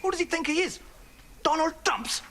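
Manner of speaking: ordinary delivery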